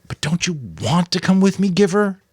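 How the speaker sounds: put-on a boy begging his mentor to come along, sadly. A narrator's reading. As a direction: ask it with a sad little hope. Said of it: "Jonas asked sadly".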